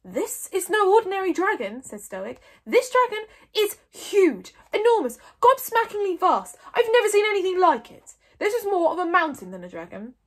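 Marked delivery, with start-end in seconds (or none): 0.0-1.8 high, loud and insistent; emphatic alarm. A narrator's reading. Stoic warning the doubting Vikings, emphatic. Stoic stressing the dragon's danger. 2.6-10.1 high, loud, building emphasis on size; awestruck exclaiming. A narrator's reading. Stoic convincing the Vikings of a monster, awestruck.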